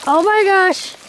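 high-pitched giddy disbelief; thrilled, almost squealing; high excited voice. Real, not acted. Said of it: "My voice is getting really high".

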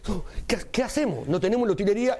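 high worried rush; panicked. Retold or imitated. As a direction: fret about the missing props. Panic before entering stage.

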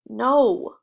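a loud, sudden no; sharp refusal. A narrator's reading. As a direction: refuse flatly, loudly. Refusing the offered food.

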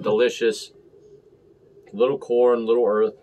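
usual speaking voice

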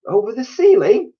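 very high, loud disbelieving question; astonished cry. A narrator's reading. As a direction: squeal the question in shock. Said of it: "they cried".

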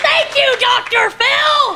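high, drawn-out sarcastic yell; mock grateful shout. Comic thanking Doctor Phil after an outburst, mock triumphant shout. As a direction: call out over-the-top thanks.

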